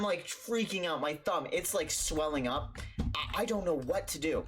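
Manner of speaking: plain reading voice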